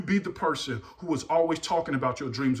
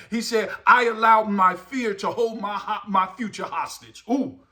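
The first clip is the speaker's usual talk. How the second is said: fervent preaching; 5 semitones higher, 5 dB louder than usual. Real, not acted. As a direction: drive each phrase home to the congregation. Preaches at full force.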